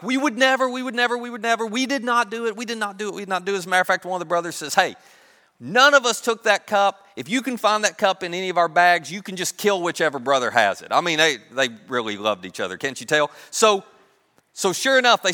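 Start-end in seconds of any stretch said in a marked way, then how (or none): 0.0-3.6 high, insistent denials tumbling out; upset protesting. Acted. Brothers deny the accusation, upset protesting. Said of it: "these brothers are so upset". 4.7-10.9 loud, high, emphatic protest; indignant denial. Acted. Brother swearing none took the cup, indignant denial.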